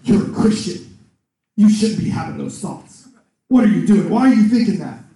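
loud, scolding accusation; accusing devil. Acted. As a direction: turn on them, berating with sharp questions. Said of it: "very next second it goes".

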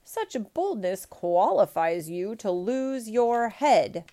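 loud, slow, menacing imperial threat; outraged. A narrator's reading. Emperor threatening the peasant, outraged. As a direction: thunder each word slowly in outrage. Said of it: "cried the outraged emperor".

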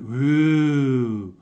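long, drawn-out mock crowd ooh; imitated audience "ooh". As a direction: imitate a whole audience reacting with a loud ooh. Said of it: "the entire audience went".

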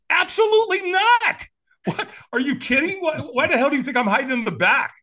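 very high, loud mock outrage; indignant refusal. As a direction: exclaim in disbelief, refusing outright. Acts out his outraged refusal.

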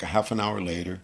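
usual speaking voice

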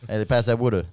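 loud Baltimore "wooder"; Baltimore accent. Acted. Friend demonstrating the Baltimore one, Baltimore accent. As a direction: say "water" as wooder, Baltimore style. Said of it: "the Baltimore one".

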